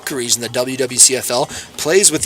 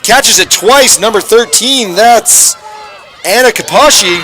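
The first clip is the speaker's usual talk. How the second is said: loud, higher live call; excited play call; 5 semitones higher, 4 dB louder than usual. Real, not acted. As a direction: call the catch with rising excitement.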